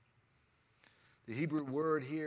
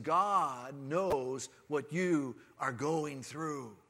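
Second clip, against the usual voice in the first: normal talking voice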